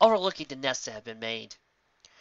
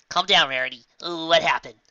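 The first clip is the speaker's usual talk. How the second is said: louder, firm reassurance; firm calming; 4 semitones higher, 8 dB louder than usual. Acted. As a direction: settle her down firmly. Calming a panicked friend.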